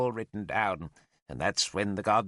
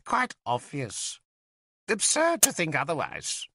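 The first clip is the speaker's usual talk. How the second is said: dismissive; 6 semitones higher than usual. Acted. Merlin scoffs at the narrator.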